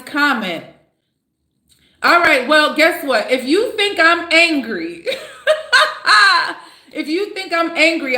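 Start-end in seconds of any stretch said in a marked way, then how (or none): 3.3-8.2 laughing through the words. Real, not acted. Shrugging off commenters who call her angry, laughing.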